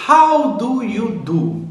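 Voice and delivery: How do you do? said very slowly, high on How, then falling; slow model phrase. Shown as a demonstration. Teacher modelling a greeting for students, slow and clear. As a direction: spell it out slowly for the class. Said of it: "Você pode falar da seguinte maneira".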